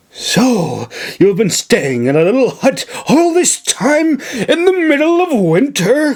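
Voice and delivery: aghast at the news; gasping shock. Acted. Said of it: "Clarice gasped".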